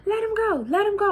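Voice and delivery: high-pitched grandma pleading to stop the fight; country grandma. Retold or imitated. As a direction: mimic grandma breaking up a brawl. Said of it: "She's so country".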